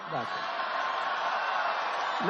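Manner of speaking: breathy whispered aside, almost no voice on "Not quite". Real, not acted. Whispered aside repeating the joke.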